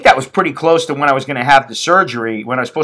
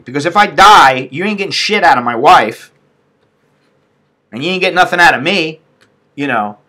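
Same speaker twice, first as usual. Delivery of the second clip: usual speaking voice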